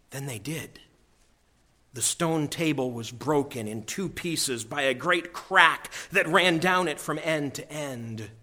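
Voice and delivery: ordinary delivery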